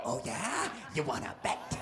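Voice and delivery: low, soft ghostly taunt. Pretend. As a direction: taunt deep and hushed. Playing ghost.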